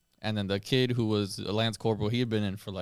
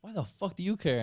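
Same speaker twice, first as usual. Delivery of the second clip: high-pitched indignant challenge, imitated; retold rant; 9 semitones higher than usual. Sergeant challenging the lance corporal who asked, mock indignant. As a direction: demand it like an irritated sergeant, in retelling. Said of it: "rants. And he's like".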